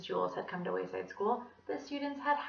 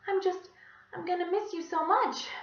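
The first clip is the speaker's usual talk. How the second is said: tearful farewell; 9 semitones higher than usual. A narrator's reading. Teacher emotional farewell.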